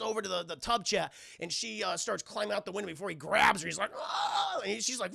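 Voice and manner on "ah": high mock monster cry; hammed-up high attack wail; zombie screech. Lewis grabs Anna at the window, mock screech.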